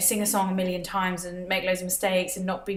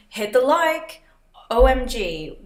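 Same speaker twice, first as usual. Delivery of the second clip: put-on American accent, brighter and louder; general American accent; 4 semitones higher, 6 dB louder, slower than usual. Vlogger reading chat comments in a general American accent. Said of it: "but with a general American accent".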